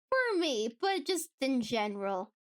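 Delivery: ordinary talk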